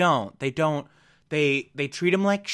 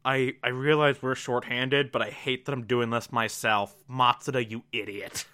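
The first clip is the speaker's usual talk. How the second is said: grumbling L; 4 semitones lower, 5 dB quieter than usual. Retold or imitated. L grumbles in the ambulance.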